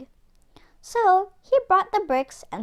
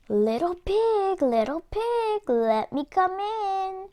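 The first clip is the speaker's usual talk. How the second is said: higher, slow sly wolf calling; wolf at the door; 8 semitones higher than usual. Acted. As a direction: coax the pig out like a hungry wolf. Wolf calls at pig's door.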